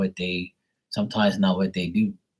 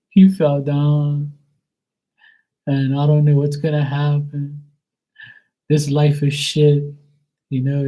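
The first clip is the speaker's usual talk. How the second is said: hammed-up distraught mother, louder and higher; upset mom; 6 semitones higher, 4 dB louder than usual. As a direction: wail it out like a melodramatic mom. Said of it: "she was mad about her brother".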